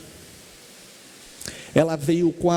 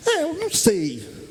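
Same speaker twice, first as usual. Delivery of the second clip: startled reply; 10 semitones higher than usual. Retold or imitated. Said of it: "Ela tomou um susto".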